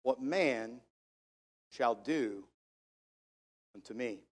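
low and soft, broken by pauses; hushed, measured. Shown as a demonstration. Preacher finishing the verse for his congregation, hushed and measured. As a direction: drop down low and let it fade.

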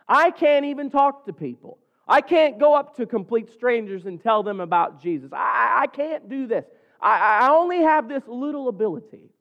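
high-pitched, hammed-up excuse-making; acted doubter's protest. Preacher acts a reluctant believer making excuses, high and protesting.

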